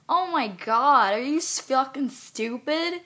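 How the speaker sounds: loud, slow, drawn-out exasperation; exasperated outburst. Real, not acted. Woman ranting at mockers of a death, exasperated. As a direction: stretch the words out in loud disbelief. Rant peaks at others' stupidity.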